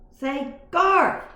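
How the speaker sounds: high, loud squeaky mouse yell; mouse shout. A narrator's reading. Mouse yelling at the top of his voice to the boy. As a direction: shout with all his tiny voice. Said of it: "at the top of his mouse voice".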